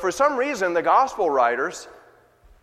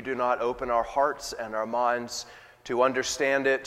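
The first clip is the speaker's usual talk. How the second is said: subdued, measured pastoral prayer; public prayer; 3 semitones lower, 4 dB quieter than usual. Real, not acted. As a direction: pray softly and unhurriedly, letting phrases settle. Leads the congregation in prayer.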